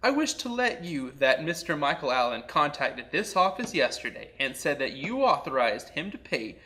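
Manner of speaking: ordinary talk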